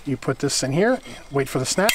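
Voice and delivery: ordinary delivery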